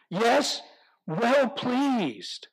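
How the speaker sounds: ordinary delivery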